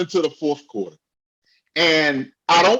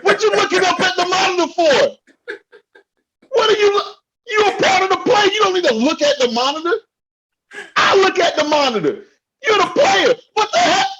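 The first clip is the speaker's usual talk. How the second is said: high-pitched yell, voice almost cracking; real exasperated yell at the player; yelling in frustration; 11 semitones higher, faster than usual. Real, not acted. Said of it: "Okay, I'm yelling".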